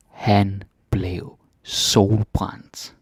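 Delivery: crawling pace, every word stretched; very slow for learners. Shown as a demonstration. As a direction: draw the sentence out for the learner. Very slow repeat.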